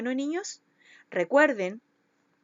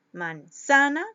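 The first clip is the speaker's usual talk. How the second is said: "manzana" said as "man-ZA-na", middle punched louder; stress on middle syllable; 3 semitones higher, 5 dB louder, slower than usual. Shown as a demonstration. Teacher trying out stresses for pupils, middle syllable hit in "manzana". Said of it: "jugando con la intensidad de la voz".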